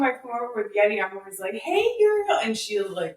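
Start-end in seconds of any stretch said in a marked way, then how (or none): 1.5-2.4 very high squealed greeting to a dog; excited greeting. Shown as a demonstration. Squealing hello to the excited dog. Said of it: "I have to be like".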